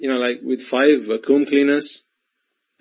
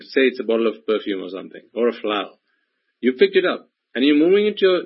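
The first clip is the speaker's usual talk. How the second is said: normal talking voice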